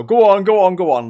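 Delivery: loud, high, insistent "go on"; pushy nagging elder. Retold or imitated. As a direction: badger them to have a drink. Pushy elders urging a drink.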